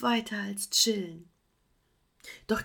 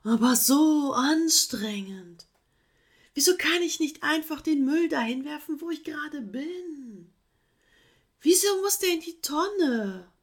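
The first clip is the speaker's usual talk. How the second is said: whiny last try; 8 semitones higher, 5 dB louder than usual. Acted. Sloth making one last lazy excuse to the koala. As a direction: whine it out, a last plea. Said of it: "wagte Fergus einen letzten Versuch".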